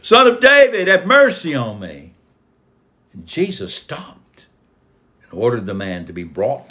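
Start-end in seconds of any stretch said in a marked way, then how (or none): 0.0-2.1 very high, loud cry for mercy; louder shouted plea. A narrator's reading. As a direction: call out at the top, insistent and pleading. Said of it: "he started shouting even louder".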